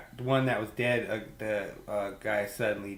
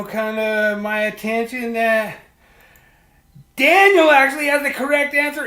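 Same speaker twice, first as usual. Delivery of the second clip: much higher, louder host reveal, slowed; excited announcement; 10 semitones higher, 9 dB louder than usual. Real, not acted. Host revealing Daniel has the right answer, excited. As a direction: announce the winner with big energy.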